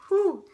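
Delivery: high, loud shaky whew; emotional exhale. Real, not acted. Vlogger steadying herself while telling viewers the story, emotional whew. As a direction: blows out breath to steady herself. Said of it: "making me emotional".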